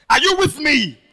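loud, high appeal with a laugh; calling out. Real, not acted. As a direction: rouse the ladies in the pews. Calls out to congregation.

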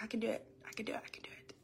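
unvoiced repeated self-pep talk; whispered to herself, fading away; whispering. Ashamed mother telling herself she can cope alone, whispering. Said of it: "I was like".

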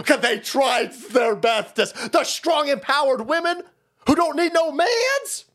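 high, slow mocking voice; hammed-up mocking voice. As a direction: sneer it in a high put-on voice. Mocking women voice.

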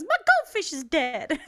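high mock-weepy wail; hammed-up tearful whine; imitated fake crying. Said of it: "he just starts fake crying".

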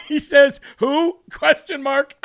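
reading through laughter; laughing. Real, not acted. Host laughing while reading a tweet's joke. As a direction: giggle the words out.

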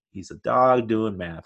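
ordinary talk

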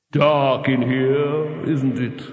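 very slow, drawn-out voice with steady pitch; ominous disembodied voice. A narrator's reading. Voice beside a man shut in a box, ominous and slow. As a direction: intone it slowly, right by his ear. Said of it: "a voice has just said".

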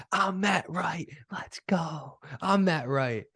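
lightly mocking self-hype; Matt Wright boast. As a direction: strut it out as the famous pro. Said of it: "I'm like".

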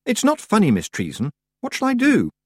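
put-on upset girl. Upset girl pleading with witch.

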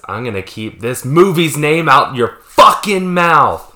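slow, loud, menacing; loud slow threat. Acted. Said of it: "That's really good".

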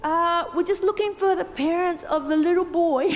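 loudspeaker announcer. Retold or imitated. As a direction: play the announcer calling for a lost boy's parents. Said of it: "I hear over the speaker".

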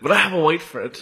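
lightly put-on mocking voice; mocked excuse-maker. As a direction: parody the same lame excuse, turned around. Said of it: "when you see people drop that shit".

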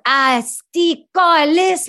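very slow, high, drawn-out Québécois dismay; dismayed sergeant. Retold or imitated. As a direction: stretch it out in shocked disbelief. Sergeant reading pill list.

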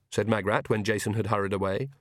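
plain reading voice

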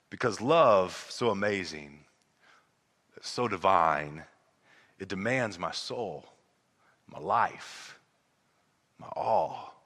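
low, soft, lingering on each phrase; reverent hymn recitation. Acted. Preacher recites an old song he loves, reverent recitation. Reciting a beloved hymn's closing lines.

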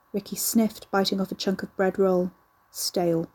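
ordinary delivery, nothing marked

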